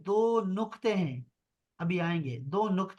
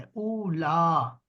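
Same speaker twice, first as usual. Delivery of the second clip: Ola drawn out very slowly as "ula"; slow demo; much slower than usual. Shown as a demonstration. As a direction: stretch out Ola like a teacher. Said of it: "ہم نے double A لکھنا ہے". (اولا بہت آہستہ، کھینچ کر)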